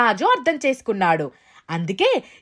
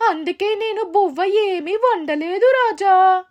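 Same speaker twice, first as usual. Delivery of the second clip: slow, higher-pitched granny voice; granny's drawn-out, raised voice; storybook granny; 6 semitones higher than usual. Acted. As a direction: explain slowly as an old granny. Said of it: "అవ్వ వద్దకు వెళ్తాడు".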